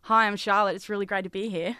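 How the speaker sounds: put-on a light Australian accent, introducing herself. Guest asked on radio to do her Australian accent. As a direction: show off a passable Australian accent. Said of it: "my Australian accent".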